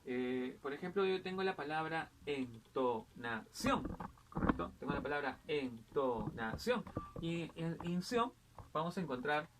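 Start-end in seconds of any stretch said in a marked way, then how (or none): 2.3-3.8 entonación split en-to-na-CIÓN, weight on CIÓN; syllable by syllable. Shown as a demonstration. Teacher finding the tonic syllable, entonación syllable by syllable. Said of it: "pronunciada con una mayor fuerza de voz". 5.5-6.8 entonación broken into en-to-na-CIÓN; syllable by syllable. Shown as a demonstration. Teacher repeating the example word, entonación syllable by syllable. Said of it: "pronunciada con una mayor fuerza de voz".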